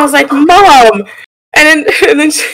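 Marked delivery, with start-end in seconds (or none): 0.3-1.0 exasperated. Retold or imitated. Exasperated at unbothered mom.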